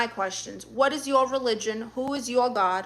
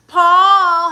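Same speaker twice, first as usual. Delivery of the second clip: very loud, high, stretched-out name; sing-song greeting; 7 semitones higher, 13 dB louder than usual. Real, not acted. Streamer greets her nephew in chat, sing-song greeting. Calls out to arriving viewer.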